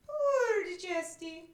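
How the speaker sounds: high, slow whiny begging; begging little sister. Shown as a demonstration. Teacher begging a sister for the sweater, high whine. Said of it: "beg your sister for the sweater".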